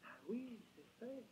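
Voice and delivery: normal talking voice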